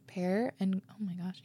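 ordinary talk

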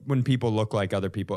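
ordinary delivery

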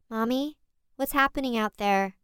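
put-on a scared four-year-old calling out; small child. Four-year-old calls from hallway.

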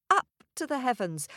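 ordinary delivery, nothing marked

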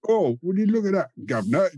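high, hammed-up Cockney chirp; mock Cockney accent. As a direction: sing it out like a chimney sweep.

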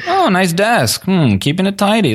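middle manager voice. Retold or imitated. Mocking a vlogger as a middle manager, in a high chirpy boss voice. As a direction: sound like a fake-friendly supervisor. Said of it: "such a middle manager thing to do".

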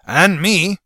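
loud, high shout; a loud, high-pitched shout from the saddle. Acted. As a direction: call it out across the riders. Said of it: "Horace shouted from the saddle".

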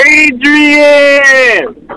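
long, high call of the name; drawn-out hype call. Real, not acted. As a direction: stretch it out like a ring announcer. Hyping up a fighter by name.

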